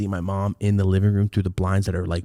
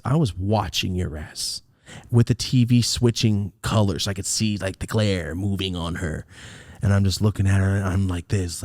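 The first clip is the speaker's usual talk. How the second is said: ordinary talk, nothing marked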